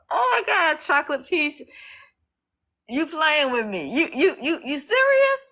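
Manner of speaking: real shock, pitch leaping up; overwhelmed disbelief. Real, not acted. Streamer stunned by Chocolate Peace, high stammering disbelief.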